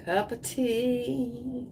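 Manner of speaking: soft, very slow, stretched-out words; hushed and lingering; dreamy savouring. Acted. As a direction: croon it softly, drawing out the last word. Dreamily savouring the card's advice.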